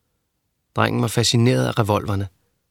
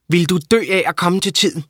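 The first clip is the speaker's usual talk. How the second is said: higher, sharp scolding mother voice; a cross old mother, pitched up; 7 semitones higher than usual. A narrator's reading. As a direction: nag him for being late.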